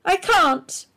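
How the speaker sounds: high, loud tearful protest; a high, loud voice on the edge of crying; bursting into tears. A narrator's reading. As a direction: let it break as the tears come. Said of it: "burst into tears".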